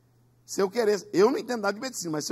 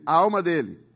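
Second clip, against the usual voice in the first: said strongly; 8 dB louder than usual. Shown as a demonstration. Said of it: "você vai dizer bem forte".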